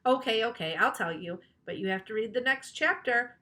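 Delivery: ordinary delivery, nothing marked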